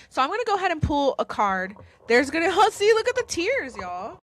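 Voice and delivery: high, fast, teary laughing; real tears breaking through a laugh. Real, not acted. Pulling a tarot card for viewers, laughing through real tears. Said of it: "I'm crying".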